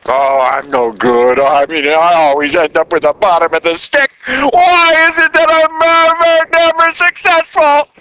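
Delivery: a hint of a defeated churchgoer feeling sorry for himself; self-pitying whine. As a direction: moan about yourself like a sorry believer. Voicing a self-pitying believer.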